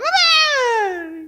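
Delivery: put-on high wailing child's call; child calling mom. Toddler calling for mom gone to the bathroom, high wail. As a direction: cry out for her like a toddler left alone.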